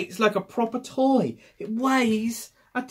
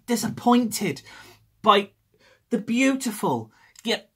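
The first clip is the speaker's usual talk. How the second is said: ordinary talk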